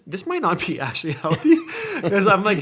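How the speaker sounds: bursting into laughter mid-sentence; laughing. Real, not acted. Laughs while confessing.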